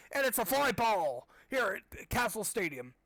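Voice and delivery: slow, high mock stadium announcer; hammed-up sportscaster voice; announcer call.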